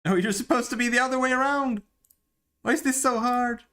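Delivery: high, loud exasperated yelling; frustrated yelling. Real, not acted. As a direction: yell at the screen in frustration. Fighting the camera controls.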